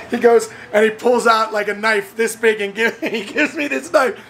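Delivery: words broken by laughter; laughing narration. Real, not acted. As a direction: tell it while cracking up. Laughing as he tells story.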